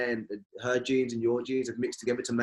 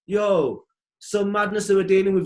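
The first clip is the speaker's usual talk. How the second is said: louder, higher exasperated outburst; rising exasperation; 7 semitones higher, 6 dB louder than usual. Real, not acted. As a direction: vent the frustration. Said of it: "exasperated outburst".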